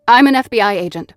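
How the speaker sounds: loud, fast, blurted British-free warning; sputtered warning. Acted. Startled agent blurts warning at intruder, loud and fast. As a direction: blurt it out in a panic. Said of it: "words that sputtered out".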